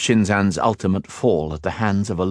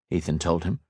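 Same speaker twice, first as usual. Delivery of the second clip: ordinary delivery, nothing marked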